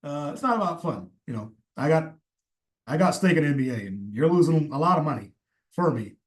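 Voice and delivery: a hint of Obama lecturing a kid about defense; Obama impression. Host imitating Obama scolding Halliburton, lightly voiced. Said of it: "That's my first ever Obama impression".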